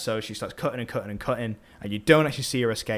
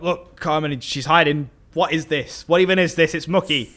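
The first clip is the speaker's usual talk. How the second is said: higher and louder, getting worked up; mock angry captain; 6 semitones higher, 6 dB louder than usual. Captain complaining to Carmen about her daughter, mock angry. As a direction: scold like a furious father showing off the mess. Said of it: "And he's like".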